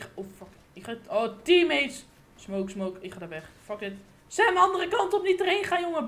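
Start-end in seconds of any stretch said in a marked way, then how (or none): 4.3-6.1 loud, higher warning; urgent call. Real, not acted. Gamer warning Sam to turn back, urgent loud call. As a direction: shout the warning across. Warns teammate away.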